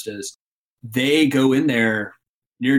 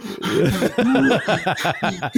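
real laugh; 7 semitones higher than usual. Real, not acted. Telling the climbing story, bursting into laughter. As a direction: break into a long laugh.

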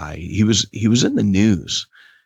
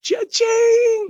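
high sung cash register cha-ching. Pretend. Playful cha-ching to his cohost. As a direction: sing out the cash register ding.

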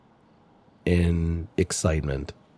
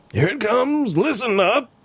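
much higher, shouted announcement; yelling. Acted. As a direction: call it out high to the crowd. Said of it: "this guy yelling".

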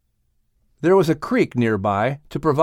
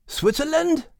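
high, startled Scottish "Switzerland?"; surprised question; 13 semitones higher than usual. Acted. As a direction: jump up in surprise. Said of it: "asked Max".

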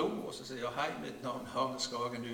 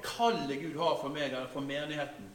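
ordinary talk, nothing marked

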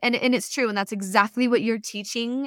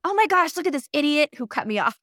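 high, louder, fast exasperation; mock road rage; 7 semitones higher, 4 dB louder, faster than usual. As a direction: vent at the driver who cut you off. Road rage while driving.

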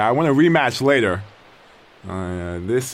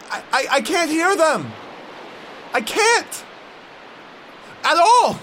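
panicked; 14 semitones higher than usual. Acted. Panicking, can't hear them.

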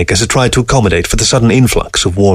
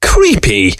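barked; 3 semitones higher than usual. Acted. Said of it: "barked Fox".

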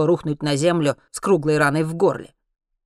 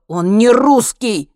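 loud, higher, drawn-out angry denial; barked retort; 6 semitones higher, 10 dB louder, much slower than usual. A narrator's reading. Henry contradicts the soldiers about the probe, angry bark. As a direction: bark it back at them. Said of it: "рявкнул Генри".

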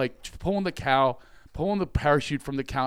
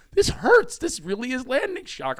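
high, louder cry of pain; high pained yelp; 12 semitones higher, 5 dB louder than usual. Retold or imitated. Caught in barbed wire, he yelps in pain. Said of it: "I'm like".

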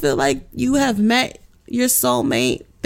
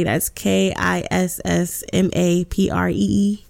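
ordinary talk, nothing marked

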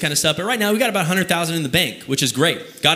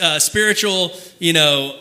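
usual speaking voice

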